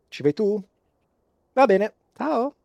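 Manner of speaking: high sing-song sign-off; lilting acted goodbye; scrolling blonde girl. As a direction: hand off the task in a high, airy voice. Blonde girl signs off to Elena.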